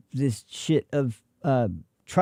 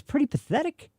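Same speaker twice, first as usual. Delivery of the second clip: higher, disgusted scorn; scornful high voice; 12 semitones higher, 4 dB quieter than usual. Real, not acted. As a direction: say it with contempt. Scornful remark.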